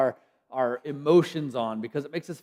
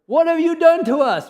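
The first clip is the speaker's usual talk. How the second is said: much higher, much louder accusing shout; angry cry to God; 12 semitones higher, 10 dB louder than usual. Shown as a demonstration. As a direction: hurl it at God in outrage. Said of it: "we can cry out in different ways".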